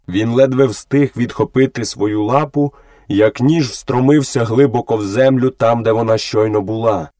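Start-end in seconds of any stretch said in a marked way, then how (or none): none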